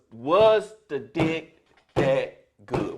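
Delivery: each word drawn out, deadpan; slow deliberate question. Retold or imitated. Father to teenage daughter in the car, slow and blunt. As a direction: lay the question down slowly and flatly. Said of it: "My first words was".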